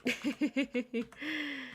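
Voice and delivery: short giggle at himself; laugh. Real, not acted. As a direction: laugh off the bad accent. Laughing at own impression.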